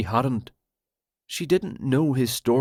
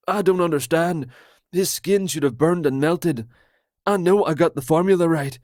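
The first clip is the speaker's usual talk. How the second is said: higher, louder, fast conspiracy rant; paranoid Roger; 6 semitones higher, 4 dB louder, faster than usual. Acted. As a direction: blurt it out like a betrayed friend.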